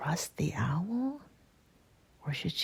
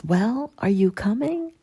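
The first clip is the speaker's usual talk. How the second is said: higher, louder prompting with rising tune; impatient owl; 6 semitones higher, 10 dB louder than usual. A narrator's reading. Owl turning back to the hesitating girl, impatient. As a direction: call back expectantly.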